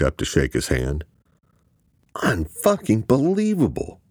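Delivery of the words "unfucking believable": high-pitched exasperated outburst; inner scream. A narrator's reading. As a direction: scream it in disbelief, without much volume. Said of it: "Luke screamed inside his head".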